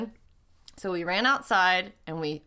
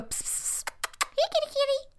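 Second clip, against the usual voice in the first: calling a cat; 3 dB quieter than usual. Retold or imitated. Calling cats outside.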